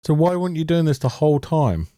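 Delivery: normal talking voice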